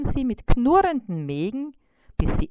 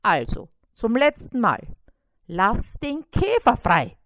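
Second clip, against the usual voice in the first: louder, firm commanding fly; bossy command; 3 semitones higher, 8 dB louder than usual. A narrator's reading. Fly ordering the spider to free the beetle, loud firm. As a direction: order it, no arguments. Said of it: "befahl Bissi".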